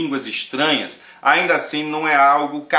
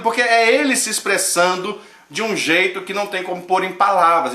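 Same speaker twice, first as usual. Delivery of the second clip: normal talking voice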